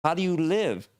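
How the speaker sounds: high, astonished questions; can't believe what he sees; a warrior from the past asks about farmland, high and amazed; baffled warrior. Pretend. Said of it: "they're gonna look at".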